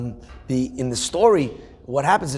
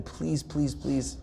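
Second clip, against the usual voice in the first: begging; 8 dB quieter, much slower than usual. Retold or imitated. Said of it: "the boy is begging".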